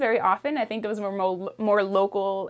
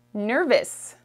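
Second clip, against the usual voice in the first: ordinary talk, nothing marked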